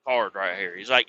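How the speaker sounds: ordinary talk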